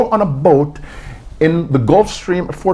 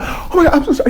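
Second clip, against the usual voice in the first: embarrassed laugh; 12 semitones higher, faster than usual. Retold or imitated. Embarrassed apology when caught reciting.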